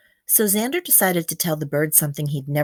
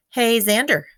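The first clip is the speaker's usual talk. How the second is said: higher, louder friendly call; Felix calling out; 6 semitones higher, 4 dB louder than usual. Acted. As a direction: call across the beach as Felix. Felix calls out to Xander.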